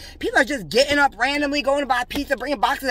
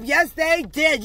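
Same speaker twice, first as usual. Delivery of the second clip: ordinary delivery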